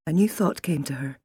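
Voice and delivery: usual speaking voice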